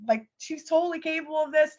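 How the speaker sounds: normal talking voice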